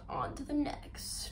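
slow whispered repeat; hushed echo. Real, not acted. Vlogger moving on to the next activity, whispered. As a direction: whisper it slowly, trailing after yourself. Echoes own words in a whisper.

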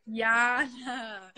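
Yana with a Y, drawn out; trying the name. Shown as a demonstration. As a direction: repeat "Yana" slowly and carefully, starting with Y. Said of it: "tell me how to say your name".

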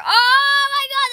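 overjoyed, sustained cry; ecstatic relief. Real, not acted. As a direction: belt it out in triumph. Relief at succeeding.

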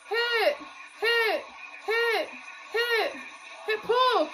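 high calls of each count; excited call-out. Real, not acted. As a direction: chant the stunt calls along. Calling counts during routine.

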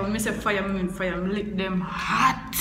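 usual speaking voice

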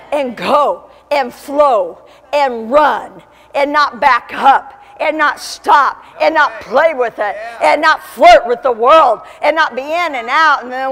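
slow, punched rhythmic list; preaching exhortation. Real, not acted. Preacher urging people to go and not back up, forceful exhortation. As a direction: drive each command home with a pause between.

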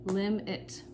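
lim and it said separately; short gap between "lim" and "it"; teacher chunking lim, it; split into syllables. Shown as a demonstration. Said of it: "it is the second syllable".

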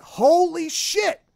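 loud, high, drawn-out shock; stunned exclamation. Retold or imitated. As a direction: blurt out your astonishment slowly. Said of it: "And I went".

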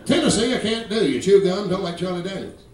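ordinary talk, nothing marked

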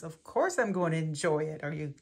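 ordinary delivery

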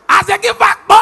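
high, loud, shouted with laughter; shouted punchline. Acted. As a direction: shout it to the crowd.

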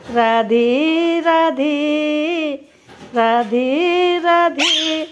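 Radhe Radhe stretched out, every syllable very long; slow chanting demo of Radhe Radhe; slow demo for parrot. Shown as a demonstration. Owner shows the parrot how to say it, very slowly. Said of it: "Radhe Radhe बोलना कब सीखेगा?".

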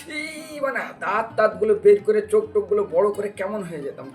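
normal talking voice

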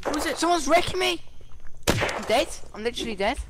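high, alarmed cry of being shot; frantic under fire; panicked gamer yell. Real, not acted. Getting shot in game.

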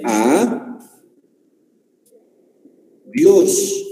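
"a", long pause, then "Dios"; split into syllables. Shown as a demonstration. Teacher showing the class how it divides into syllables, split apart. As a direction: say "a Dios" syllable by syllable, widely separated. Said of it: "Cómo sería en sílabas".

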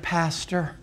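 soft Pastor. Retold or imitated. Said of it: "Whenever I hear that".

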